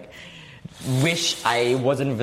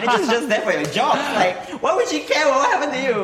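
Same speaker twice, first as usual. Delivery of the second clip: high, laughing and louder; 14 semitones higher, 5 dB louder than usual. Real, not acted. Laughing mockery of managers.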